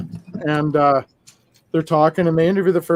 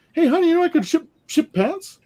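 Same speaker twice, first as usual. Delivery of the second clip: much higher, cartoonish sales-pitch voice; cheesy ad husband; 12 semitones higher than usual. Mocking the Kmart ad husband, high hammy pitch. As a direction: ham up the guy in the commercial. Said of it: "He goes".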